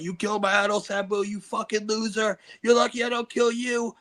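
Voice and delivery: high, wailing tearful threats; imitated crying fan. Crazed fan threatening him at a show, high mock sobbing. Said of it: "He started crying".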